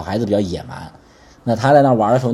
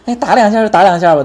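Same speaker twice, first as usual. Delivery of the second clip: mock dismissive shrug, pitched much higher; dismissive brush-off; 10 semitones higher, 4 dB louder than usual. Pastor imitating parents who shrug off a child being hit, dismissive. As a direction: wave it off, a couple of hits is nothing. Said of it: "我们中国有时候，有时候教育".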